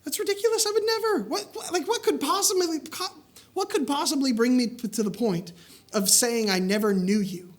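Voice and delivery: high, laughing disbelief at the very idea; incredulous protest. Retold or imitated. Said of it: "Just like we all would say".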